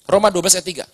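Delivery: usual speaking voice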